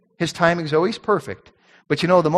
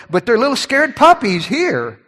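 ordinary delivery

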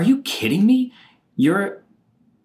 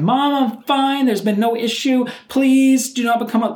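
high, loud yell to mom; yelling in the background; 11 semitones higher, 4 dB louder than usual. Retold or imitated. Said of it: "I'm yelling in the background".

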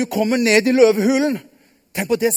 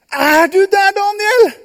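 high, loud call; 10 semitones higher, 6 dB louder, slower than usual. Acted. The king calls down to Daniel, loud. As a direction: shout the question down into the den.

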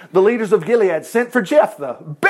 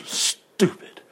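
whispered scorn; 7 dB quieter than usual. Real, not acted. Preacher judging the custom.

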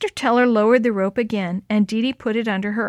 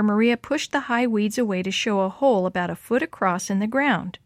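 ordinary delivery

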